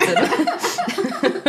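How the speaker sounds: laughing and choking on a drink; sputtering after swallowing wrong. Real, not acted. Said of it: "Jetzt hab ich verschluckt".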